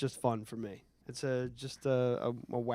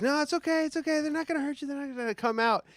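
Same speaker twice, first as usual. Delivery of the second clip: much higher, mock-soothing mother voice; soothing mom; 13 semitones higher, faster than usual. Retelling how a mom hushed her frightened son, much higher. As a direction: imitate a mom calming her scared kid. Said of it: "And his mom's like".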